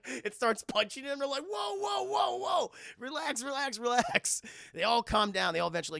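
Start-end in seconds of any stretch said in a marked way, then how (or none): 1.5-4.4 high, shouted pleas to stop; acted frantic calming; alarmed intervention. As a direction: break up a fight. Crew breaking up fight, acted.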